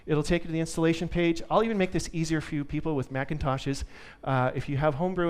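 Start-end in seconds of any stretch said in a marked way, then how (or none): none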